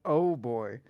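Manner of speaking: ordinary delivery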